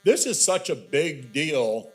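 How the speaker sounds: normal talking voice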